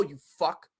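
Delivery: usual speaking voice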